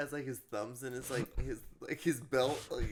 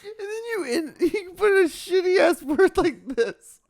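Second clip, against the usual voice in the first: talking through high laughter; cracking up mid-sentence; laughing; 5 dB louder than usual. Real, not acted.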